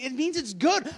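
loud, high-pitched comic defense; exasperated husband. Acted. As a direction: insist on it loudly in a mock quarrel. Husband defending himself, acted quarrel.

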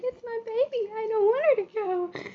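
high, wailing mock sob of a tearful mom; imitating mom crying. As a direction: whimper it like a weepy mother letting go. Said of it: "my mom starts crying because she's like".

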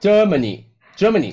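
slow "Germany", weight on GER; stress on first syllable. Shown as a demonstration. Host coaching co-host on Germany, slow stress on GER. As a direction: lean slowly on the GER of "Germany". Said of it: "重音在前".

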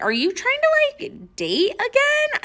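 baffled high-pitched question to her ex; incredulous question. Retold or imitated. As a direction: ask as if you can't believe it. Confused by his vague excuse.

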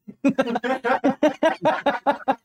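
high breathless giggle; giggle, almost crying. Real, not acted. Still cracking up.

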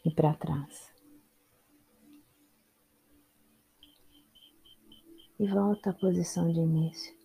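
unhurried meditation guide with long pauses; calm instructions in short, spaced-out phrases; guided meditation. Real, not acted. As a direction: guide them through the body, leaving space after each instruction.

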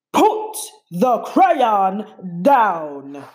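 very slow menacing monster growl. A narrator's reading. Gabe threatening Emma, slow growl. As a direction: loom over her with every word. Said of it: "as he growled".

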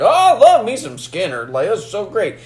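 lightly acted sheriff raving about a band; sheriff gushing. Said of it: "Sheriff's like".

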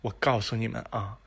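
usual speaking voice

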